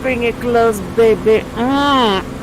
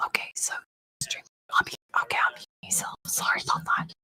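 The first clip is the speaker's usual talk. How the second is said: whisper; 11 dB quieter than usual. Real, not acted. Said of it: "why are we whispering again?".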